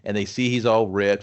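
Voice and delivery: ordinary delivery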